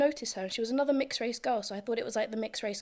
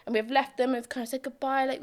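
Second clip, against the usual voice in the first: usual speaking voice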